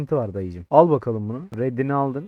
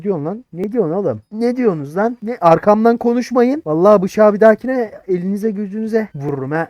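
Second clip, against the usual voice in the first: higher, loud mock threat; angry threat; 7 semitones higher, 8 dB louder than usual. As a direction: yell a playful threat. Threatening chat behind his back.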